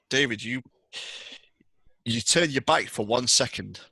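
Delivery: usual speaking voice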